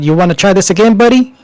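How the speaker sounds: challenging threat. Acted. Manager showing his gun to the orc, challenging. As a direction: dare him, pitch climbing to the last word.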